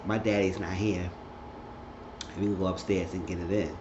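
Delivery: hushed, playacted come-on; retold flirt, softly under the voice; seductive invitation.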